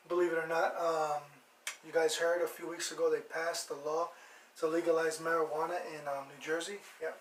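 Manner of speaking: normal talking voice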